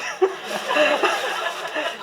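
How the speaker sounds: big high burst of laughter; high laughing fit. Real, not acted. Chef cracking up at his own story, laughing hard.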